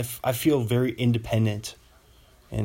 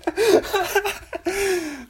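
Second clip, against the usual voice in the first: high squealing laugh. Real, not acted. Laughs at own bit.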